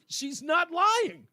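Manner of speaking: replaying the dodge with ridicule; mocking replay. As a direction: savor it slowly and mock it. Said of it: "I just wanna hear it again".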